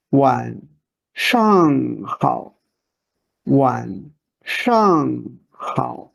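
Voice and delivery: in "上中下" the "中" stands out strongest; middle word stressed. Shown as a demonstration. Teacher showing learners middle stress in "上中下". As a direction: lean on the middle syllable, slowly. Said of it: "Emphasize the second word".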